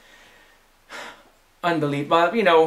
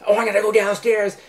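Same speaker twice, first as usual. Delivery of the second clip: mild mock yell, higher pitch; retold yell; 6 semitones higher than usual. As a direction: imitate her calling out, raised but not full volume. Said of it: "Kim yells at me".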